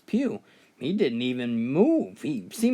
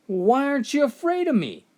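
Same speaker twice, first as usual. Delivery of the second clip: much higher, exasperated demand; the devil losing patience with the old man; perturbed; 10 semitones higher, 3 dB louder than usual. Retold or imitated. Devil demanding an answer from the calm old man, irritated. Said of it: "more than a little bit perturbed".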